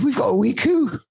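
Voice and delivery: high mock Scottish falsetto; Mrs Doubtfire-like Scottish lady. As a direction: proudly announce it in a put-on Scottish lady's voice. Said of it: "I'm loving the channeling of Missus Doubtfire".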